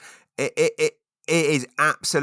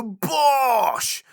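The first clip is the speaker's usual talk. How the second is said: much higher, loud victory "Bosh!"; triumphant bosh; 5 dB louder than usual. Shown as a demonstration. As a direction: shout it walking in with a win. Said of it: "you go".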